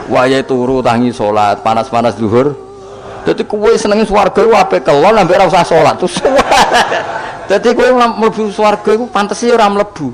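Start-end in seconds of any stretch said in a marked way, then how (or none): 6.3-7.5 high, airy burst of laughter; hearty laugh. Real, not acted. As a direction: laugh out at the joke. Laughs mid-sentence. (ngguyu ngakak)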